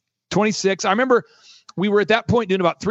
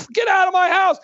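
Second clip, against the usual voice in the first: mock scream; 12 semitones higher than usual. Retold angry woman throwing brick.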